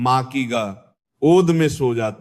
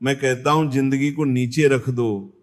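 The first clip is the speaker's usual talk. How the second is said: ordinary talk, nothing marked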